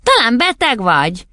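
high-pitched questioning mother; puzzled mother. A narrator's reading. Mother wondering if her daughter is ill, high-voiced. Mother wakes sleeping Matilda.

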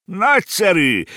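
high, loud delighted cry; uncle's excited shout of praise; exclaiming. A narrator's reading. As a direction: cheer the stunt on loudly. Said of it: "kiáltott fel a bácsi".

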